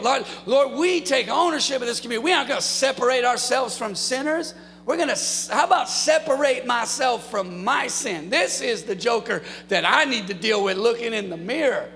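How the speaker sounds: impassioned pastor crying out to the church; fiery preaching. Acted. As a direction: preach it out with full force. Preaching builds to full force.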